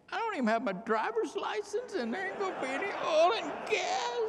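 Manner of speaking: high, loud and alarmed, like a kid hearing bad news; young boy's startled outburst; excited disbelief. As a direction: voice jumps way up in mock panic. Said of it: "And I'm thinking".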